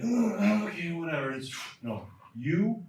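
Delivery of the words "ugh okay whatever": higher, dismissive grunt then shrug; mock indifferent lifter. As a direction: imitate a lifter who can't be bothered. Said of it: "get on the bar and think".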